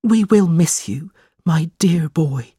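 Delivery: mild sorrow, fading into a whisper; a touch of a mother's tearful farewell; sad mother. A narrator's reading. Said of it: "looked at her son sadly".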